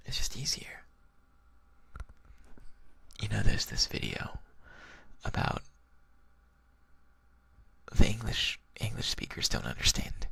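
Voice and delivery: breathy, near-whispered relaxed talk; sleepy, whispered ASMR-style chat; hushed whispering. Real, not acted. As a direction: murmur it close, with long pauses. Quiet, rambling whispered talk.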